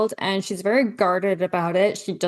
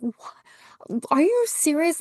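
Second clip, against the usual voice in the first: breathless gasp, then a much higher outraged question; shocked disbelief; 9 semitones higher, slower than usual. Retold or imitated. Viewer reacting to the baby scene, mock shocked disbelief. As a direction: gasp as if you cannot believe it.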